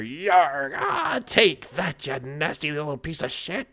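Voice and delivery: high, strained battle yell, then a sneer; battling sailor cry. Acted. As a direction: roar as you swing, spit the insult. GM voices fighting sailors.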